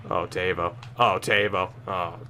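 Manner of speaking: low, soft groan of letdown; crestfallen, fading to almost nothing; deflated disappointment. Real, not acted. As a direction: lament the miss, sinking away quietly. Lamenting a missed chance.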